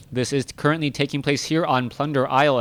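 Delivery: usual speaking voice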